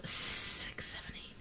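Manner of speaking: whispered count under the breath; whispering. Real, not acted.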